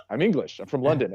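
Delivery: ordinary talk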